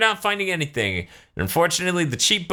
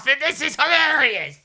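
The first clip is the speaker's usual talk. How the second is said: high mock Pilaf gang voice; 10 semitones higher than usual. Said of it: "they're like".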